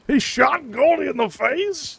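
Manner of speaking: high, slow shocked disbelief. Real, not acted. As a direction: gasp it out in total shock. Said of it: "I'm genuinely shocked by that".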